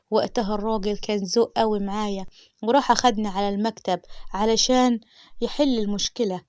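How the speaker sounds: plain reading voice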